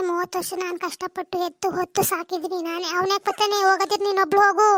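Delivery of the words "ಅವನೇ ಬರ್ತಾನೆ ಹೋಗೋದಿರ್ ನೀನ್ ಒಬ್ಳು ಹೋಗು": louder angry retort; mother-in-law snapping at daughter-in-law in a quarrel. Acted. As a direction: throw it back at her.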